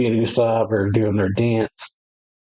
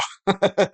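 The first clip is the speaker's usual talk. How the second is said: high laugh; 9 semitones higher than usual. Real, not acted. Laughing at the giants' toast.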